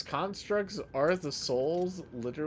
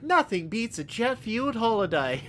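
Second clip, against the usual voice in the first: meme quote; 8 semitones higher than usual. Retold or imitated. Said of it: "the meme where it's like".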